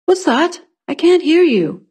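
lifted voice, questioning someone at a distance; young man calling. A narrator's reading. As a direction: call out that you can't hear.

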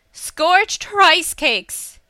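loud, high, drawn-out exclamation; frustrated cry. A narrator's reading. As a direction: cry it out as an exasperated curse. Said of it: "Hisa cried".

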